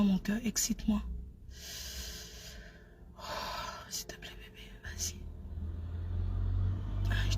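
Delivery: very soft pretend lover's murmur, often whispered; intime au téléphone. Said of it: "Je commence à faire la niasse". (chuchotement amoureux joué au téléphone)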